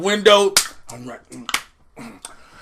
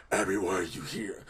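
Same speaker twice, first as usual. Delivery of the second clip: much lower, softer swamp monster; low mumbling Swamp Thing; 14 semitones lower, 15 dB quieter than usual. Retold or imitated. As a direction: grumble it down low. Swamp Thing voiced in recap.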